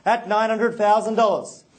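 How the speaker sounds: rhythmic auction bid calling; auctioneer call. Real, not acted.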